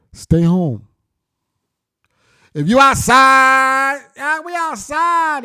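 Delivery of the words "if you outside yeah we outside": high, slow, mocking kid bragging voice; mock street kid. Mocking the kids who brag about being outside, high and slow. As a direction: ham up a boastful kid, drawn out and high. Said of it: "Got all the kids programmed with the ignorance".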